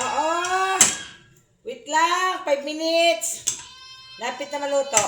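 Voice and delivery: loud, high call across the room. Real, not acted. Calling to a crying child.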